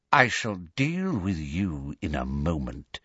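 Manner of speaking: low, soft threat; dangerous. A narrator's reading. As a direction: promise punishment quietly. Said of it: "said Voldemort dangerously".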